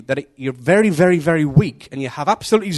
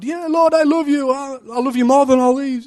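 high, hammed-up boasting; mock boast; 11 semitones higher, 4 dB louder than usual. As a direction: parody a proud believer, much higher. Mocking a boastful believer.

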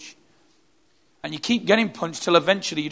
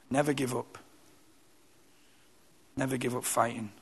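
lower, softer resolve; quiet exhortation; 4 semitones lower, 8 dB quieter than usual. Real, not acted. Preacher to the congregation, quiet resolve. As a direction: urge them gently never to quit.

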